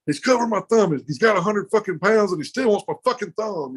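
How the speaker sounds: high-pitched mock outrage; mad, raising hell. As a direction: imitate an angry opponent's complaint. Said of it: "he's all mad, raising hell".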